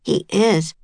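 usual speaking voice

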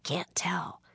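put-on hushed, voiceless boy's answer; whispered reply. A narrator's reading. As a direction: whisper it so nobody nearby hears. Hiding, answers sister's whisper.

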